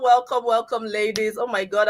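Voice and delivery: usual speaking voice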